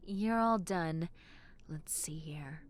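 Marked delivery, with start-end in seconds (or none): 1.7-2.6 whispered to yourself. Acted. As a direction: murmur under your breath over the x-ray. Examining x-rays, whispered.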